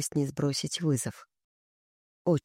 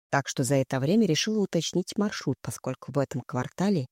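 ordinary delivery, nothing marked

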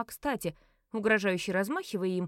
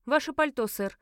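raised, louder opening on a brisk request; firm request; 4 semitones higher, 4 dB louder than usual. A narrator's reading. Narrator asks the nearest gentleman for his coat, firm request. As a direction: address a stranger with a firm demand. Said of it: "обратилась я к ближайшему господину".

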